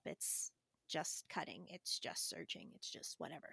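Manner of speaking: hushed self-talk. Real, not acted. Calming herself about the project.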